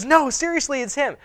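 high-pitched, pleading insistence, acted out; Rhoda insisting. Acts Rhoda insisting to doubters.

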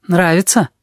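put-on high, odd little boy voice; odd kid voice. A narrator's reading. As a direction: play the strange kid, high and eager. Kid character voice.

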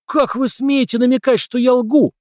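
high, loud thundering outrage. A narrator's reading. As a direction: boom it out in outrage. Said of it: "прогрохотал Дональд".

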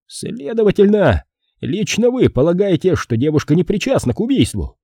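high-pitched, pressing question; nervous policeman. A narrator's reading. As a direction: query it as an agitated, high-voiced official. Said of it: "нервно потирая подбородок". (нервный полицейский, высокий голос)

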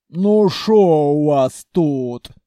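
loud, slow rough bass; troll-like bass. A narrator's reading. Khalya comes out to the clerk, loud troll-like bass. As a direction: growl it like a troll.

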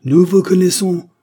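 high, loud accusing cry; cried out. A narrator's reading. Barbachko cries out at the refugees, high and loud. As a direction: fling it at them. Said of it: "s'écria Barbachko".

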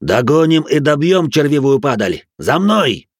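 loud battle command; rally the warriors, shouting; shouted order. A narrator's reading. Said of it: "он приказал". (громкий боевой приказ)

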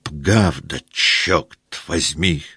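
rolled-r lisp, Пгавда for правда; Denisov's burr. Acted. Denisov shouting agreement with his burred r. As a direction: swallow the r like Denisov. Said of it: "закричал".

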